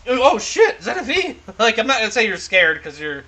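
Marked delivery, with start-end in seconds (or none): 0.1-1.3 acted startle, much higher; startled exclamation. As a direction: jump at suddenly noticing someone beside you. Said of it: "you're like".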